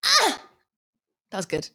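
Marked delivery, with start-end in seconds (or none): 0.0-0.4 tennis grunt. Shown as a demonstration. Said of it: "go on, you do yours".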